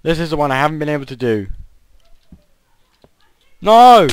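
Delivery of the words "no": a sudden, high, loud cry of defeat; frustrated yell. Real, not acted. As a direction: yell as you fall off the jump. Fails the jump, yells.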